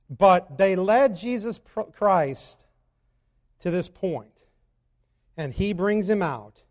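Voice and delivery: ordinary talk, nothing marked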